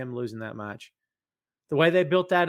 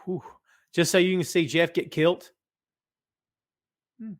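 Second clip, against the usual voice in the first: ordinary talk, nothing marked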